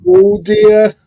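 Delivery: very high, loud "oh dear"; mock dismay. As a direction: tease them with exaggerated, high-pitched alarm. Teasing someone who is in trouble.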